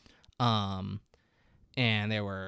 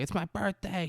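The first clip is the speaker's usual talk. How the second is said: put-on drunken yelling; 9 semitones higher than usual. Hammered girlfriend gets back to the house, high mock yell. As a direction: imitate a hammered birthday girl protesting. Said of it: "She's yelling".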